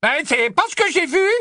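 high-pitched, wavering objection. A narrator's reading. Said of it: "avec moins de certitude cependant".

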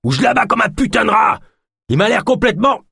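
high, shouted threat; angry roar. A narrator's reading. Man on the rock threatens to shoot Yosp, roaring. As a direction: bellow the threat in exasperation. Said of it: "rugit l'homme".

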